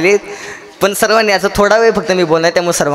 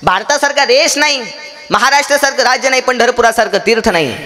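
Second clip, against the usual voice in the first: high-pitched litany, each phrase falling away; rousing preaching; 6 semitones higher than usual. Real, not acted. Preacher extols India and its heroes to the congregation, rousing.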